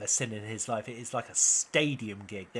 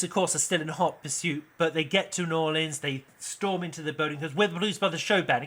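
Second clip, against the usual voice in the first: ordinary talk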